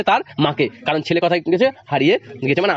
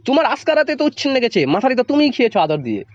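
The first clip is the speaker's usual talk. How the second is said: angry blame; 7 semitones higher than usual. Acted. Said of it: "বাবা এবার মার উপর মার মুখে হয়ে ওঠেন".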